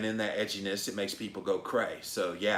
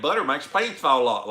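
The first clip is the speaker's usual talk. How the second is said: exaggerated Southern accent, higher and louder; fake Southern accent; 5 semitones higher, 10 dB louder than usual. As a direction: belt it out in a put-on Southern twang. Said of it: "fake Southern accent".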